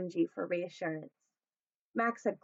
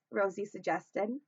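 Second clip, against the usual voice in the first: ordinary delivery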